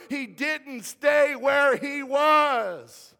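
loud, high, stretched-out key words; impassioned sermon climax, ringing out; full-force preaching. Real, not acted. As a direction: preach it at a shout, drawing out the punch line.

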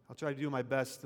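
ordinary talk, nothing marked

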